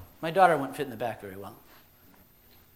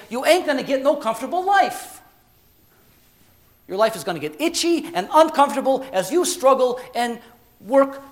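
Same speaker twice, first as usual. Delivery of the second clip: insistent, higher-pitched genie making the case; streetwise genie; 8 semitones higher, 7 dB louder than usual. Acted. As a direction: argue it like a brash genie. Genie argues back.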